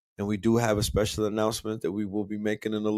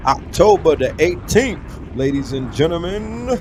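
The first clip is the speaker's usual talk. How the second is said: slow, drawn-out hype announcer voice, louder; event announcement; 3 semitones higher, 3 dB louder, slower than usual. Acted. Streamer announces the Ital Gardens event to viewers, slow hype read. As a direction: stretch out every word like a host.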